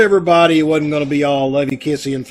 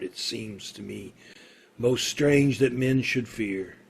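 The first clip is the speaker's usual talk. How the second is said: poem recitation; 5 semitones lower, 8 dB quieter than usual. Acted. Condemned man reciting Shakespeare before death, softly. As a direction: recite the verse quietly to yourself.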